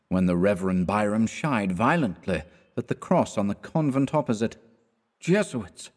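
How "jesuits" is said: muttered. A narrator's reading. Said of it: "he muttered".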